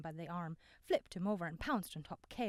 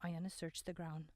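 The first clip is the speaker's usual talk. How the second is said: ordinary delivery, nothing marked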